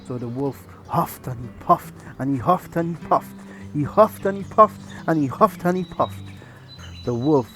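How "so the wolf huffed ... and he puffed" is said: usual speaking voice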